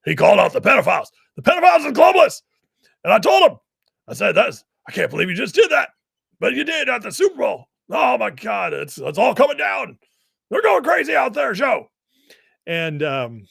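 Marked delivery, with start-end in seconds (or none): 0.0-11.9 high, loud, fast, frantic ranting; Alex Jones impression. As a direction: rant like a radio host who has seen it all. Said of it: "I love Alex".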